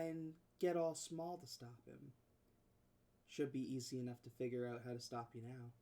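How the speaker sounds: low, quiet, menacing villain. Acted. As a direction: taunt them softly, coldly. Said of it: "you hear Revenger say".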